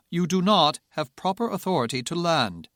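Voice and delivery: stern British controller; lightly British, firm refusal; British accent. Acted. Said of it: "the British tower controller".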